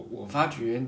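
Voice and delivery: ordinary talk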